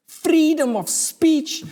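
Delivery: rally the crowd; proud speech voice; rousing emphasis. Acted.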